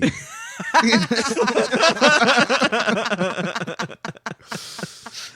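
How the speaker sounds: long, high helpless laughing fit; real, high-pitched giggling fit; helpless laughter. Real, not acted. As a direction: burst out laughing and keep going until it runs out. Bursts out laughing at the name.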